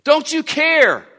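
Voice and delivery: desperate shout over the storm; panicked cry. Acted. Disciples waking Jesus in the storm, panicked shouting. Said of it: "And so they ask a question".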